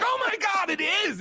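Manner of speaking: high, loud excited yell; excited shout. Retold or imitated. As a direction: shout it out in shock. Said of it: "And she's like".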